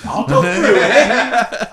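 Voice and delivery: slow words through laughter; laughing. Real, not acted. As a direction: laugh the line out slowly. Laughing while starting to explain.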